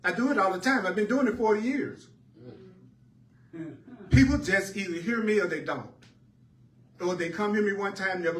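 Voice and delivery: high, loud, delighted testimony; loud, high preacher's triumph; exuberant preaching. Acted. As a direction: lift it up and shout the joy. Preacher rises to exuberant peak.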